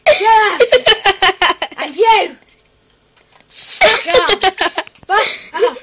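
loud, high laughing shouts at brother; laughing yell. Real, not acted. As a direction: shout him down while cracking up.

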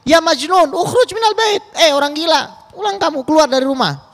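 high-pitched acted outburst of shock; shrill-pitched, hammed-up order to get out; startled shriek. Shocked woman throwing the madman out of her house, acted startled outburst. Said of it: "cewek itu kan kaget".

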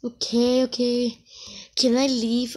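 usual speaking voice

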